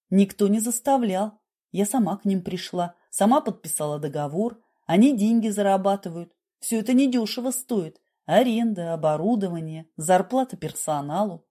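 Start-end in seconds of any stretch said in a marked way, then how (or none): none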